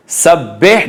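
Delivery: सब्बिह said as "sabbeh"; wrong "beh" vowel. Shown as a demonstration. Teacher pointing out the error, wrong "beh" vowel. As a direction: let the i of सब्बिह slip to e. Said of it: "नहीं है".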